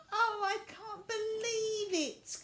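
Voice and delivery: high, slow delighted cry; joyful cry. A narrator's reading. Violet crying out with joy at reaching the cottage. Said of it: "cried Violet".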